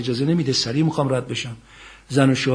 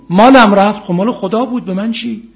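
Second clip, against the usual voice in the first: preaching at full force; 10 semitones higher, 15 dB louder than usual. Acted. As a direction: ring out the verse to the congregation. Preaching Quranic verse at full force.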